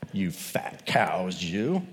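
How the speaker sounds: slow whispered jab; whispered insult. Retold or imitated. Preacher whispering Amos's jab at the rich women, slow. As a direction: hiss the insult under the breath.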